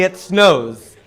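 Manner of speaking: ordinary talk, nothing marked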